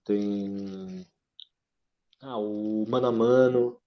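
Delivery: ordinary delivery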